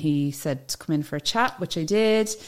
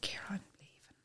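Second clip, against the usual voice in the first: much softer, breathy whispered line; whispered confession; 3 semitones higher, 22 dB quieter than usual. Retold or imitated. Telling her boss she's quitting, in a hushed whisper. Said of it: "I said".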